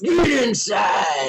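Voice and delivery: slow stretched command to a dog; dog yelling voice. Shown as a demonstration. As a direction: bark it out slowly like scolding a dog. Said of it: "Oh, yeah, the dog yelling voice".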